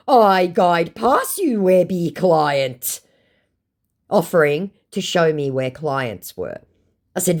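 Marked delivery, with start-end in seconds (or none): 0.1-3.0 slow, raised, louder impression; mock boastful scammer. Scammer offering to show clients, mock boastful. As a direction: stretch each word as a put-on brag.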